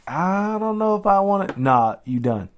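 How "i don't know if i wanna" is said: mock hesitation. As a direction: drag out the first word, mimicking indecision. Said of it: "if you said".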